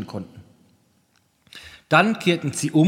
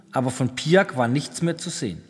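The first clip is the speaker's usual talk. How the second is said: plain reading voice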